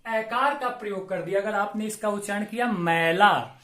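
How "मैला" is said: मैला with an open ai vowel in place of e; wrong vowel demo. Shown as a demonstration. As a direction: contrast मैला against मेला. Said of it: "अर्थ का अनर्थ हो जाएगा".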